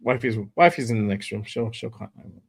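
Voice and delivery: ordinary talk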